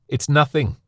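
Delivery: high, loud, choked shout; bitter shout. Acted. Son shouting bitterly after departing Anil. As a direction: hurl the words after him.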